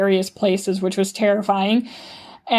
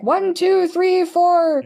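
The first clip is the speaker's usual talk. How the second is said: imitated yelling; 9 semitones higher, much slower than usual. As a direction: imitate someone calling out a count, pitched well up. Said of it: "yelling things".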